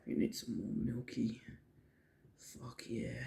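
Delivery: unvoiced quick whisper. Real, not acted. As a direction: whisper it under the breath. Whispered aside while cooking.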